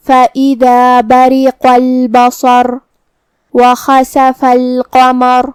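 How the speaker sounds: slow tajweed recitation with long-held vowels; Quran recitation. Shown as a demonstration. Teacher opens the lesson's verses, slow Quran recitation. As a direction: recite slowly and evenly, drawing out the syllables.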